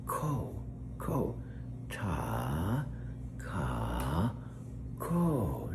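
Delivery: Ta ka ko sung out syllable by syllable, pitch swooping; syllable coaching. Shown as a demonstration. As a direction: teach Takako as three slow syllables. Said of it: "I'm Alexa's voice coach".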